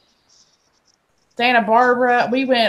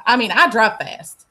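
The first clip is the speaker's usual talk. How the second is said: ordinary talk, nothing marked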